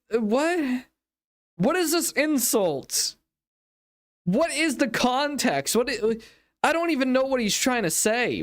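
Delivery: real exasperation, pitched high; exasperated bafflement. Real, not acted. Host questioning what he's trying to prove, exasperated. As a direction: demand answers in a high, baffled voice. Baffled reaction to the other's act.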